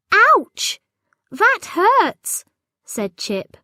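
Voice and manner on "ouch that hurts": high, loud yelp of pain; ouch of pain. A narrator's reading. Chip yelping as the goblins pinch him. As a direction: cry out as if pinched hard.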